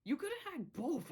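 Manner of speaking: high, mock-sing-song quip; teasing retort. Vlogger retorting to Matt, high teasing quip. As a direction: tease the villain in a high voice. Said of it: "And I'm like".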